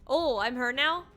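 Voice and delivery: usual speaking voice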